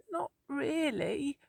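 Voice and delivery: high, slow and timid, barely there; small wobbly voice. Acted. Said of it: "in a small wobbly voice".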